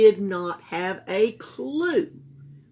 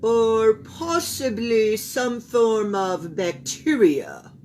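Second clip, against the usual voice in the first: doctor voice; 4 semitones higher, 8 dB louder, slower than usual. A narrator's reading.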